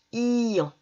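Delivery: "ian" with the i held long, gliding into nasal an; drawn-out I. Shown as a demonstration. Teacher showing pupils the I A N sound, i drawn out. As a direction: insist on the i in "ian". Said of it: "je dois insister sur le I".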